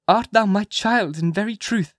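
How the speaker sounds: high, slow questioning mother; half-earnest question. A narrator's reading. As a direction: ask it slowly with some real earnestness. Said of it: "with a portion of genuine earnestness".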